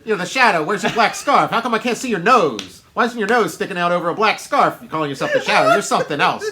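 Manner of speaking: loud, high-pitched Philly heckler; hammed-up mocking harangue; heckling Philly local. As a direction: rant like an unimpressed local tearing a costume apart. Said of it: "They're gonna tell you, like".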